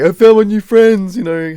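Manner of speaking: high, very loud mock drunken joy; put-on boozy holler. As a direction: imitate a drunk crowd cheering new mates. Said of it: "they're all drunk".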